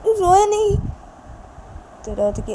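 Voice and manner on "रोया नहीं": much higher, astonished exclamation; surprised. Acted. Wife exclaims in surprise that the baby stopped crying. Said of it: "Ravi हैरान हो जाता है".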